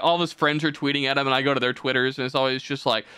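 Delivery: usual speaking voice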